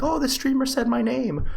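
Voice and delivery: high, gushing fan voice; giddy high fan impression; mock starstruck fan. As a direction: squeal like a thrilled viewer. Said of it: "You know, like".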